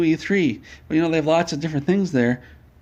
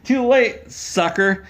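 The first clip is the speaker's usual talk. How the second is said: mock taunt; 7 semitones higher, 3 dB louder, slower than usual. Thief taunting while fleeing.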